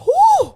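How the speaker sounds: high, loud "whoo!"; excited whoop. Retold or imitated. Retelling the pre-workout kicking in, excited whoop. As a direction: let out a big pumped-up whoop.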